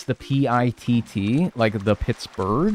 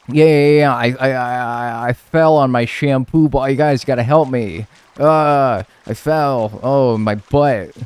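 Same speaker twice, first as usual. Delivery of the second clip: mock patient; 3 semitones higher, 5 dB louder than usual. Imagined patient stammering to the ER staff. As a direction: play a sheepish patient begging for help.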